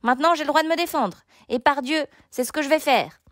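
high, heated protest; indignant cry. A narrator's reading. Gauvin claims his right to fight back, indignant cry. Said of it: "cria-t-il".

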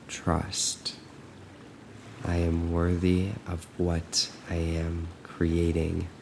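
soft, slow affirmations with long pauses; guided meditation. Acted. Coach leading listeners to repeat trust, softly and slowly. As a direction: let each phrase sink in, quiet and unhurried.

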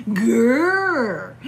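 loud playful bear growl. Retold or imitated. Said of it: "He'd say".

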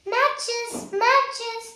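high, loud street seller's call; hawking matches on a street corner; shouting wares. Acted. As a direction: call out to sell to passers-by. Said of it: "started, uh, shouting".

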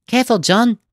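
put-on high, loud young boy's warning; worried boy. A narrator's reading. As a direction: warn a friend up a tree. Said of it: "said one".

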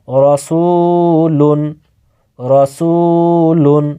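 রসুলুন stretched very slowly, final নুন held; teacher's slow model pronunciation; slow drawn-out recitation. Shown as a demonstration. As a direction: draw out রসুলুন slowly for the pupils. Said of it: "একবার উচ্চারণ করে".

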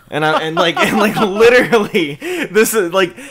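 high, loud, through real laughter; words breaking into laughter; laughing while talking. Real, not acted. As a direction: crack up while telling the story. Cracking up at own retelling.

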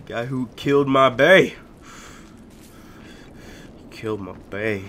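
ordinary talk

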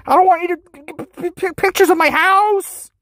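high, stammering fake panic; mock panic. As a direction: squeak it out in silly alarm. Mock panic about house.

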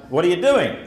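put-on British speaker putting on a hammy American accent as the cop challenging him; mock American cop accent. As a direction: bark it like a US police officer, in a hammed-up American accent. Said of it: "if anybody could do an American accent".

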